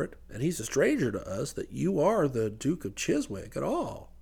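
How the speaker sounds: low, soft, reasonable businessman; explaining businessman. A narrator's reading. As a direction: lay out the terms quietly, pitched low. Voices businessman explaining the deal.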